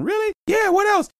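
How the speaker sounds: high cartoon falsetto, disbelieving; squeaky doubting mutant. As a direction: squeak it out like a nervous sidekick. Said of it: "like Professor X would describe Wolverine".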